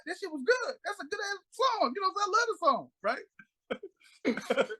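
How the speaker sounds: high-pitched imitation of a gushing man; mock gushing fan. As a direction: gush in a high put-on voice. Imitates [name] gushing over song.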